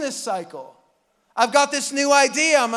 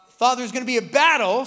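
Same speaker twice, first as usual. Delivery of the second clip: ordinary delivery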